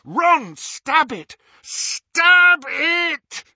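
high, loud yell building to a drawn-out shout. A narrator's reading. Harry urges Ron to destroy the locket, yelling. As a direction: yell the order, harder the second time. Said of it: "Harry yelled".

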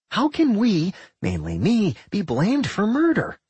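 high, whiny complaint; a petulant little dungeon; childish dungeon voice. A narrator's reading. Doc voiced by narrator.

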